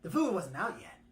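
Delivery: ordinary delivery, nothing marked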